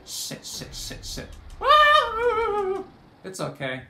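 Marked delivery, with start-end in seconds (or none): 0.1-1.3 softer whispered cursing under breath; panicked whisper. Real, not acted. Panic as enemy arrives. 1.6-2.8 very high, loud startled yelp; real scream of surprise. Real, not acted. Getting hit suddenly.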